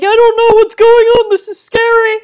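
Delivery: high, loud mock panic; hammed-up frightened cry. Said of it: "all the Catholics were like".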